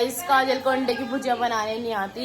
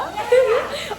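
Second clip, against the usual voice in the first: high, loud laugh at her own mistake; real giggle, pitched way up; burst of laughter; 12 semitones higher, 4 dB louder than usual. Real, not acted. Laughing at own word mix-up.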